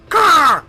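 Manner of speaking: loud high crow squawk; imitated crow cry; crow caw.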